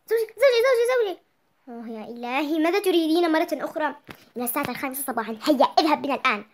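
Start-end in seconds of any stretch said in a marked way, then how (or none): 0.1-1.2 high loud repeated call; wife waking her husband, calling loud and high. Acted. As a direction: rouse him out of bed. Wife wakes husband at dawn.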